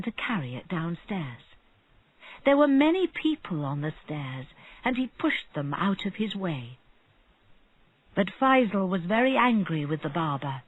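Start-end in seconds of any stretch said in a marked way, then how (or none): none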